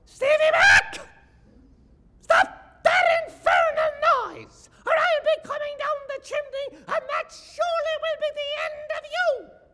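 very high, loud screeching ghost; caterwauling screech. Acted. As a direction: shriek it like a wild banshee. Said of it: "a caterwauling screech".

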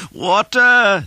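high, loud cry of many men; big high-pitched holler; joyful shout. A narrator's reading. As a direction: shout it out at the sight of it. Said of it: "they shouted".

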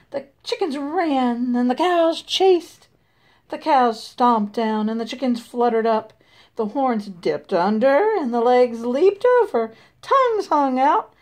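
plain reading voice

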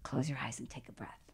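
soft unvoiced whisper, calm; inner voice whisper. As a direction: whisper it like a voice inside your head. Inner voice heard in a calm moment.

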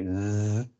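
a long buzzing z with the voice on; voiced z. Shown as a demonstration. Teacher showing a voiced z. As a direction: hum the z sound. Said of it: "you have your voice box vibrating".

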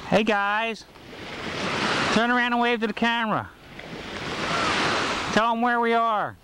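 ordinary talk, nothing marked

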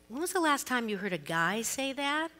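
usual speaking voice

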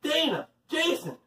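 high-pitched calling of names, no real volume; mock shout. A narrator's reading. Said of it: "shouted Allison".

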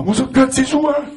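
very high mock cashier voice; cashier's retort. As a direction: snap it back like a nosy cashier. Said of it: "La cassiera fa". (la cassiera ribatte con voce acutissima)

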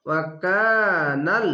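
ওয়াকানাল slow, with the কা stretched one alif; Quranic recitation demo. Shown as a demonstration. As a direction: draw out the কা in ওয়াকানাল. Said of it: "এক আলিফ টেনে পড়তে হবে". (কা এক আলিফ টেনে পড়া)

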